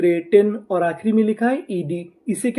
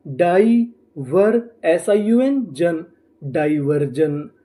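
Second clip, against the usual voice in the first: very slow, piece by piece, then blended; slow sounding out; 3 semitones lower, much slower than usual. Shown as a demonstration. Teacher to students, sounding out each code slowly. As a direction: spell it out slowly for the class. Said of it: "तो हमारी meaning क्या बनी?".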